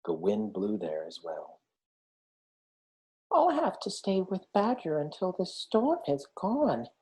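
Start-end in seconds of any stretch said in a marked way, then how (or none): 3.3-6.9 lightly raised small hedgehog voice; a hint of a small, worried hedgehog; Little Hedgehog. A narrator's reading. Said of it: "he said at last".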